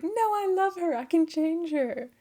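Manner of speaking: hammed-up besotted king refusing to listen; lovestruck protest. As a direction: plead like a smitten fool. Lovestruck king defends snake woman.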